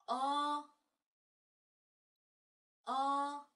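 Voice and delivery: loud, high, drawn-out Korean o; model vowel. Shown as a demonstration. Teacher drills the vowel for students, loud and clear. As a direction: hold the o for learners to copy. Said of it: "Tiếp theo đó là".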